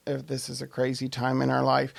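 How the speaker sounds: ordinary delivery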